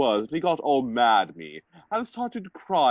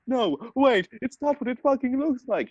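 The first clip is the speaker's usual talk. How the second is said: mock shout; 13 semitones higher than usual. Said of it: "he shouted".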